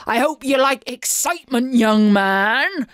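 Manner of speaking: loud, theatrical pirate captain; booming. A narrator's reading. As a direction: boom it out like a menacing pirate headmaster. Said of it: "he boomed".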